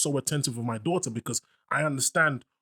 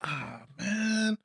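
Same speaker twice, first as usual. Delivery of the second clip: put-on awkward kid voice; 6 semitones higher than usual.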